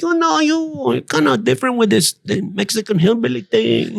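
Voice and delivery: high, put-on voice for the older comic; lightly mocking impression of a fellow comic; imitating Paul Rodriguez. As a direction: mimic Paul Rodriguez with a laugh in it. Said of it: "Paul Rodriguez saw me there and he was like".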